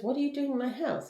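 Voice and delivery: high-pitched retold confrontation; lightly acted, high indignant question; mock indignant. Woman finds a stranger in her house, mock indignant.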